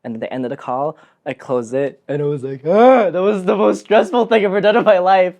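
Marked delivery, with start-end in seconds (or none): 2.6-5.4 loud, high burst of pent-up stress; venting relief. Retold or imitated. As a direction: let it all out after a stressful call. Venting after the stressful call.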